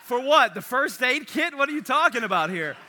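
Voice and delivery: can't believe what you're hearing; mock bewildered protest; incredulous. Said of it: "And I'm like".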